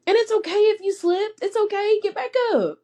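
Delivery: high, teary and pleading; tearful encouragement. Real, not acted. Mother urging listeners who slipped, teary and pleading. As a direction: comfort them while close to tears.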